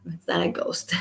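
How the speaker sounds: softly whispered joke. Real, not acted. Joking to viewers that the noise is no ghost, whispered. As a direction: breathe it out as a hushed aside.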